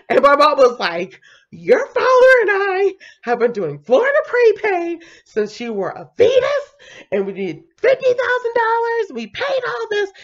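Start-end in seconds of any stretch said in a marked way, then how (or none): 1.5-10.1 much higher, louder indignant mom voice; high outraged parent near tears; shocked mom. Retold or imitated. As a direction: play the stunned mother who paid for everything. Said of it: "she was shocked".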